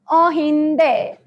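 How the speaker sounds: drawn out, slow and teasing; slow teasing question. Pretend. Drawn-out teasing question to viewers. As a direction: stretch it like a quiz host. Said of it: "what do you think my tita told me?".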